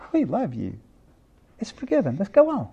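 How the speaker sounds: put-on soft, tender reassurance to a child; reassuring parent. Parent reassuring crying daughter.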